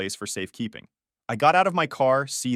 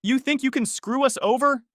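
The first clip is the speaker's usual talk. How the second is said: high, voiced menace rather than a whisper; angry hiss; 12 semitones higher than usual. A narrator's reading. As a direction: confront them with a sharply raised pitch. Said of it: "he hissed".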